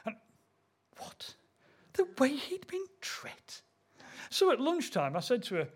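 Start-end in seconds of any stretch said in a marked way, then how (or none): 1.0-3.6 slow, half-whispered incredulous echo; hushed, hammed-up shock; mock disbelief. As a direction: repeat it slowly, aghast, voice jumping high. His mock-shocked reaction to her dialect word.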